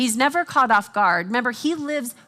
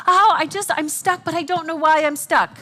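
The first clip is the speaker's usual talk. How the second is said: higher, louder mock complaint of being stuck; hammed-up whine of feeling stuck; whiny stuck person; 5 semitones higher, 4 dB louder than usual. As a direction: play the frustrated friend who is stuck, a bit higher and louder. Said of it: "in conversations going".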